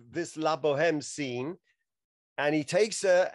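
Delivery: ordinary talk, nothing marked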